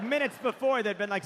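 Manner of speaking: usual speaking voice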